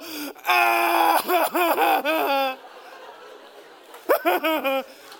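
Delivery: long, loud fake bawling; mock child's wail. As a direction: bawl like a kid dragged away. Child wailing at having to leave.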